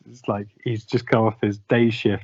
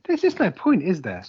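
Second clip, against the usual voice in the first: exasperated; 7 semitones higher than usual. Real, not acted. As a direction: voice jumps up high in despair. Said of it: "You sound like you're losing your mind".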